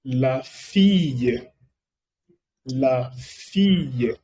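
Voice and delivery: la fille drawn out slowly; slow French demo. Shown as a demonstration. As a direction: model la fille slowly for repeating. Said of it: "Aquí también es". (la fille muy despacio)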